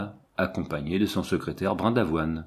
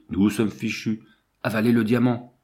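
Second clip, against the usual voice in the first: ordinary delivery, nothing marked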